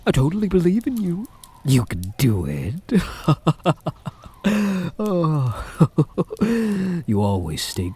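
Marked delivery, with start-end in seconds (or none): 0.1-3.0 high, fast, sing-song "I totally believe in you"; sarcastic. Acted. Mocking hunter's escape hopes. 3.3-7.1 high giggling laugh; mocking laughter. Vampire laughing at hunter.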